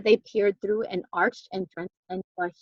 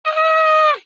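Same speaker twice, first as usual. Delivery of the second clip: high, loud shriek of fright; terrified scream; 7 dB louder than usual. A narrator's reading. Jack fleeing the floating bone, loud frightened scream. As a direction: let out a panicked scream. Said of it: "yelled Jack".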